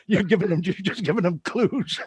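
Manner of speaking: teasing through real laughter; laughing accusation. Real, not acted. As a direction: laugh helplessly as you accuse him. Host breaks up teasing his guest.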